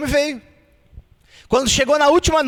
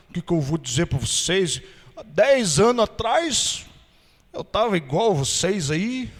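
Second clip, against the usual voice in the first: lower, unhurried humble voice; slow plain talk; 7 semitones lower, 3 dB quieter than usual. Retold or imitated. Ex-smoker testifying to smokers: slow humble voice. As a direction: talk slowly like a simple man. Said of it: "falando assim devagar".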